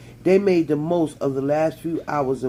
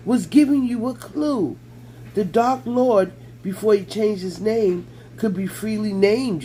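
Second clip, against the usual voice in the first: higher, eager little elf pleading its point; excitable house elf, pitched up; 6 semitones higher than usual. A narrator's reading. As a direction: pitch up into a squeaky, earnest elf. Dobby the elf voiced.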